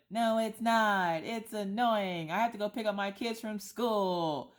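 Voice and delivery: high, slow mocking whine; mocking my own exasperation; exasperated reply. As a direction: whine it back in annoyance. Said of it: "And I'm like".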